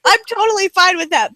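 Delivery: normal talking voice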